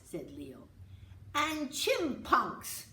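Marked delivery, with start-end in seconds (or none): 1.3-2.9 loud slow excited cry; yelled. Acted. Little brother eagerly yells about Grandpa's animals, slow loud call. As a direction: shout it out proudly, stretched. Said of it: "yelled Uli".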